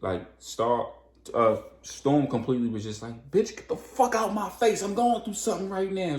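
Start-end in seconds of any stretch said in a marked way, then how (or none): 3.3-6.2 high, loud furious snap; angry snap. Retold or imitated. Storm snaps at Tony, high and loud. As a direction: snap at them, fed up and hurting. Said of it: "She snaps".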